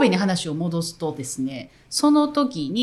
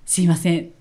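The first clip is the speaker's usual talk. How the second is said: ordinary delivery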